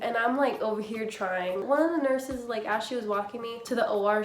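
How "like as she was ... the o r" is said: normal talking voice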